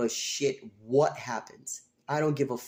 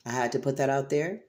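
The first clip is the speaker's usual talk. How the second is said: ordinary talk, nothing marked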